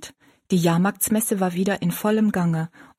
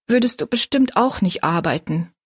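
usual speaking voice

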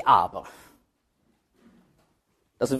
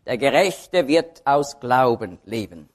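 slow, lower line leaning on Gerechte; weight Gerechte, let the rest sink; stress on Gerechte; 5 semitones lower, slower than usual. Shown as a demonstration. Preacher citing Romans on righteousness, stress on Gerechte. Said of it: "jedes Mal liegt die Betonung aber ein bisschen anders".

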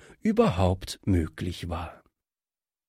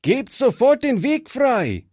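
calling out; 11 semitones higher than usual. Acted. Fritz calling to the bandits to clear the road. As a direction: order them out of the way. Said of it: "rief Fritz".

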